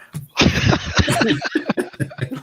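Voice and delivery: unvoiced high burst of laughter; real laughter at the story; high breathless laugh. Real, not acted. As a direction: crack up, breathless.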